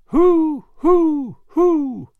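loud, high hoo calls to the echoes. Acted. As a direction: call out hoo across the river, loud and high. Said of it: "went Paul on the dike".

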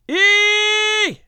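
long high-pitched scream; piercing "ee" shriek; loud scream held and fading. A narrator's reading.

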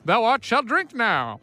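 mock old-time speech. Joking about early beer pong players, mock old-time proclamation. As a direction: proclaim it slowly like a hammed-up olden-days decree. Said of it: "Is that how they talked".

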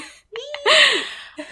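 loud shrieking giggle; high squealing laugh. Real, not acted. Burst of laughter.

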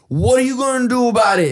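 high, goading challenge; taunting. Retold or imitated. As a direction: bait him into a fight. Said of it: "he looks at me".

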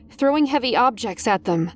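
ordinary delivery

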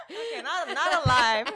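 high-pitched words breaking into laughter; laughing. Real, not acted. Reassuring herself it is not live, laughing. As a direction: talk through a real giggling fit. Still cracking up over slip.

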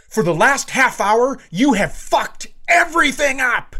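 retold teen screaming at her mother. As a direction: scream it at your mom in rage. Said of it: "And screaming".

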